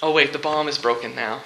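ordinary talk, nothing marked

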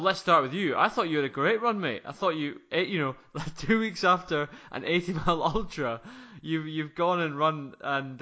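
ordinary delivery

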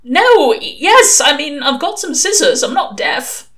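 loud, high and stumbling, then settling; flustered protest. Acted. Shouted-at recruit insists he has scissors, flustered protest. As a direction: blurt the answer, tripping over it.

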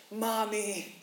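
high mock child's call for Mom; child crying out. Pastor imitating his child calling for mom, high cry. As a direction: wail for Mommy like a kid from bed. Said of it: "They would cry out".

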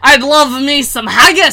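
badly faked Scottish accent; mock Scottish accent. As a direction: ham up a Scottish brogue. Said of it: "mushing right back into Russian".

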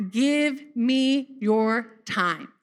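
"Give me your time" drawn out slowly with weight on give; slow it right down and lean on "give"; slow, deliberate repeat of "Give me your time"; slow emphatic. Shown as a demonstration. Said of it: "Did you just catch that?".

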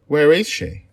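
put-on high, loud worried child asking; anxious child. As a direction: demand an answer from the general. Child asks where friend went.

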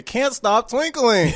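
high, drawn-out mimicry of the name; mocking imitation. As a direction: imitate the silly delivery, slow and high. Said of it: "you're saying it wrong".

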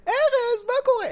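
very high-pitched sing-out hello; mock cheery greeting. Friend overheard mid-row switches to hello, high-pitched cheery greeting. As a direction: call out a greeting in an exaggerated high voice.

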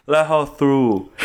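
slow, drawn-out mumbled catchphrase; Venezuelan Tommy Wiseau. Retold or imitated. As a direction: lightly imitate an odd commander, words stretched out. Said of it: "he's like a Venezuelan Tommy Wiseau".

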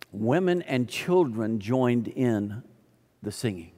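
slow, measured, dropping away softly on the last words; slow verse reading. Real, not acted. Preacher reading a verse to the congregation, slow and measured. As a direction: read the verse out slowly, low at the end. Reads verse, then repeats it.

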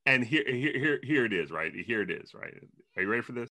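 usual speaking voice

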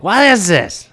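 high-pitched imitation of a yelling woman; mock yelling. Random lady yelling at a passerby about the weather, mock yell. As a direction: wail it like an outraged passerby, imitating. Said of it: "She started yelling".

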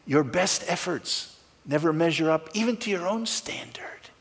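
hushed whispered aside; a whispered confession to the congregation. Real, not acted. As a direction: drop to a confiding whisper. Sermon aside on imperfection.

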